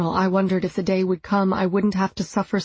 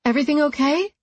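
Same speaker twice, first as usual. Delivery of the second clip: raised, questioning call across the grove; 7 semitones higher, faster than usual. A narrator's reading. Woman calling to her fiancé out of sight, raised questioning call. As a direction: call out toward the trees, higher. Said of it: "I called out".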